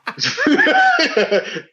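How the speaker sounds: long heavy sigh; deep sigh. Real, not acted. Groaning at a sequel announcement, deep sigh. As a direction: let out a big tired sigh. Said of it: "Deep sigh".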